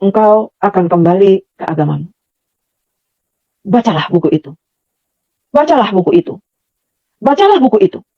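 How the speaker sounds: measured, solemn imitation with long pauses; scripture translation style. Mysterious voice commands her to read the book, in measured recitation. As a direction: pronounce each command formally, leaving space after it. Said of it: "Begitulah kira-kira".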